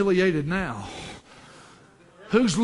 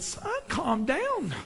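put-on soft, slow, higher-pitched old man's hush; old man calming a child; 8 semitones higher, 6 dB quieter, much slower than usual. Old man to the excited little boy, slow calming voice. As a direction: draw it out quietly to settle him. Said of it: "That old man looks over and said".

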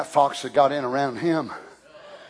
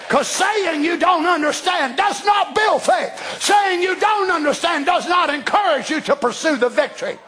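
full-force preaching; 9 semitones higher than usual. Acted. Preacher shouts to the church about the gene of God. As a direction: preach it out to the congregation.

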